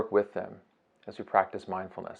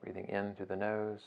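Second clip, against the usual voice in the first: soft, unhurried meditation voice with long pauses; guided meditation; 7 dB quieter than usual. Acted. Teacher guiding listeners through a beach breathing meditation, soft and unhurried. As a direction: ease them gently through each breath, phrase by phrase.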